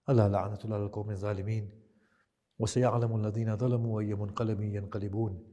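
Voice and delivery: fast, rattled-off Arabic recitation; preacher closing the majlis with a rapid prayer. Acted. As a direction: run through the closing duas quickly.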